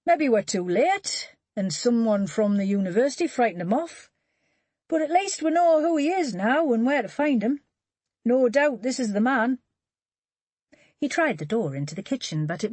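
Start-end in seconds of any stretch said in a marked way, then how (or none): 0.1-9.6 higher, louder character voice for Charlie; Charlie's voice. Acted. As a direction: Charlie thinking aloud, voiced apart from narration. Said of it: "Charlie said".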